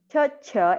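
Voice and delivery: cha, cha said crisply with a ch sound; teacher modelling cha; demo syllable cha. Shown as a demonstration. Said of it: "Everybody follow me?".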